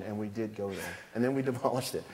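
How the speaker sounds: normal talking voice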